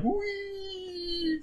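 very high shouted "wee" squeal; imitated thrilled shout. Body surfer whooping past the GoPro, high squeal. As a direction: cry out high like you're flying down a wave. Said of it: "he was like".

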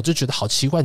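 ordinary talk

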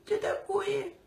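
very high squeaky voice. Retold or imitated. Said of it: "Кто таким писклявым голосом у нас говорит?".

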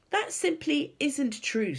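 high, slow, indignant denial; indignant girl, stressing each word; angry cry. A narrator's reading. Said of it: "Sophie cried angrily".

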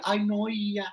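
whispered high fake-innocent whine; whispered prissy protest; mock whiny whisper. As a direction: mimic her playing innocent under her breath. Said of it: "Así se hacía".

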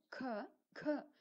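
க twice, each with a puff; aspirated k. Shown as a demonstration. Teacher drilling learners, க breathy as kha. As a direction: let air burst out on each க. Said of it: "ஏர் வெளியே வரணும்".

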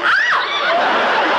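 high playful scream; mock shriek. Mock shriek, rubber ducky bit.

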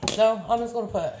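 normal talking voice

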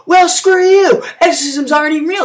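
acted angry comeback; indignant retort. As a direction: fire back, high and offended. Exorcist argues back at dad.

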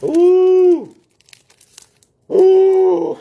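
loud, high ooh at the smell. Real, not acted. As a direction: react loudly to the fumes. Strong smell from hot chip.

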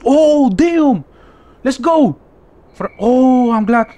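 high, loud thrilled cheer; excited shout. Real, not acted. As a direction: cheer it in delight. Excited at reward in game.

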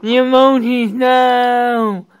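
loud, high cry of no; dismayed shout. Real, not acted. Player seeing the emojis go, dismayed shout. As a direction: yell it out in dismay.

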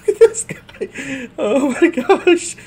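high, loud words broken by laughter; laughing hard. Real, not acted. Laughing at silly game animation.

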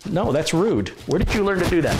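high, mock-stern scolding of a naughty dog. Real, not acted. Owner scolds the dog grabbing her microphone, high and mock-stern. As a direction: tell off the dog in a high playful voice.

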